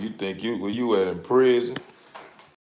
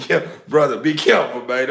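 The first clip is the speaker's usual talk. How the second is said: normal talking voice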